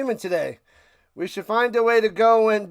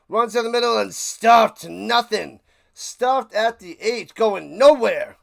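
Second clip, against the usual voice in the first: louder, slowing commentary with "nowhere" drawn out; play-by-play call; 3 semitones higher, 6 dB louder, slower than usual. Acted. Calling the game for his viewers, live play-by-play. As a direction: call the stuffed run like a broadcaster.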